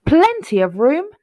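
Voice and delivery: loud, high happy finale; celebratory storybook close; triumphant finish. A narrator's reading. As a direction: ring it out as the big ending.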